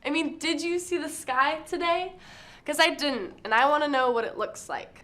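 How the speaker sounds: emphatic poem delivery, pitched above everyday talk; slam poetry performance. Acted. Student poet asking if you saw the sky, performed slam delivery. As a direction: perform it to a room as spoken word.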